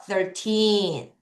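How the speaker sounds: put-on Thirteen with a long, strong TEEN; thir-TEEEN, ending held; stress on TEEN. Shown as a demonstration. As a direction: stretch the second syllable of Thirteen. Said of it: "틴을 강하게 읽겠습니다".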